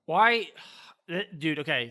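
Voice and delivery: usual speaking voice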